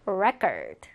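"record" said REC-ord, falling after the first syllable; stress on first syllable. Shown as a demonstration. Teacher repeats the noun form, first syllable of "record" stressed. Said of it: "명사는 먼저 어떻게 발음한다".

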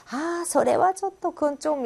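high, slow, reluctant trail of words; acted awkward, drawn-out demurral; evasive hedging. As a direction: dodge a request without saying no. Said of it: "이렇게 얼버무리면서 이렇게 피하는 식으로".

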